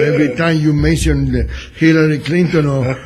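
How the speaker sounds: ordinary talk, nothing marked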